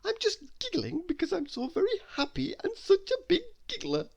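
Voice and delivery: very high, sweet little voice; high squeaky little-girl voice; giggly waitress. Acted. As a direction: protest innocently like a tiny happy girl.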